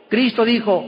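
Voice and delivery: ordinary delivery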